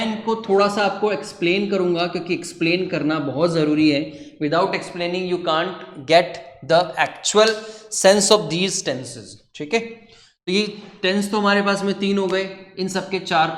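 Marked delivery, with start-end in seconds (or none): 4.4-9.3 very slow, deliberate teacher emphasis; slow lecturing stress; slow emphatic English. Shown as a demonstration. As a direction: spell out each word for the class.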